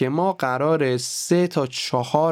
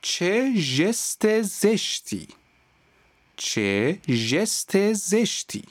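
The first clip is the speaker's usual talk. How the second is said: very slow and higher, every sound spelled out; slow drill; 9 semitones higher, much slower than usual. Shown as a demonstration. Teacher reading the practice tongue twister slowly. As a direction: drag out each letter of ژست زشتی. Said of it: "این چند جمله".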